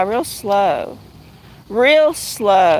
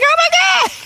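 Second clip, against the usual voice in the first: much higher excited squeal; silly excitement; much faster than usual. Retold or imitated. Retelling her silly reaction to a flower find, high squeal. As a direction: replay the over-the-top thrill. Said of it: "Just me being silly, like".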